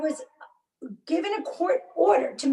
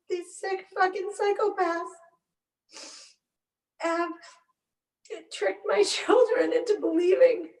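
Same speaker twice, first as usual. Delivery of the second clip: real crying, bitter and strained; tearful outrage; 4 semitones higher than usual. Real, not acted. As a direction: force the accusation out through tears. Mother tearfully condemns those responsible.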